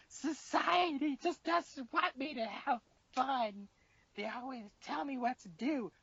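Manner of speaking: high, slow, whiny fake sobbing; mock drunk crying. Drunk girl left alone on the bed, mock tearful wail. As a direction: imitate a drunk girl wailing, hammed-up. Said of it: "She's literally crying".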